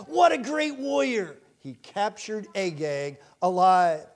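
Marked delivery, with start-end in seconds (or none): 0.1-1.4 high, punched-up cry of admiration; mock acclaim. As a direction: cheer the king like an impressed crowd. Imagined people praising King Saul.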